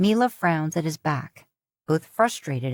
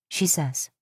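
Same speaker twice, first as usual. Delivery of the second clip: plain reading voice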